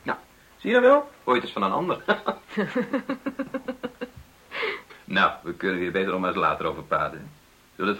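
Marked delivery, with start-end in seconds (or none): 0.0-1.1 triumphant. Acted. Father triumphant. 2.1-4.2 high laugh. Acted. Father laughing.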